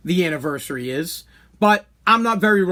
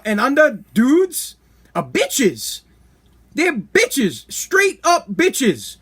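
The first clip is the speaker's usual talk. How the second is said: ordinary delivery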